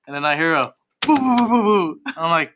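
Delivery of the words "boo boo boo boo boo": high-pitched boo-hoo imitation; mock sobbing. Tattooist imitating a client crying in the restroom, mock sobbing. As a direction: imitate someone wailing through a door. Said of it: "like, sobbing, like, crying".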